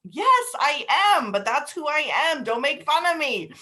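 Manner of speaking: mock indignant comeback; retorting tearfully. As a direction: defend yourself like you did back then. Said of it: "And I was like".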